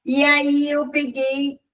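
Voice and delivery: usual speaking voice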